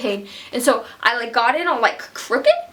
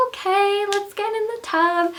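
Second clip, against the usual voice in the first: higher, light calm midwife voice; chill midwife quote; 9 semitones higher than usual. Retold or imitated. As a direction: voice the midwives, high and easy. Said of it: "they're like".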